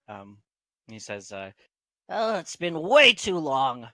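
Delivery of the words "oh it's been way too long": higher, drawn-out halfling reply; Garth. Acted. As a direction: lift the voice and stretch it out slowly. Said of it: "Garth replies in Halfling".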